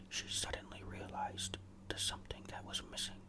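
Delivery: usual speaking voice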